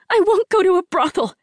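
high, loud panicked yell. A narrator's reading. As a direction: scream the refusal in panic. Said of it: "Rin yelled".

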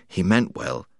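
usual speaking voice

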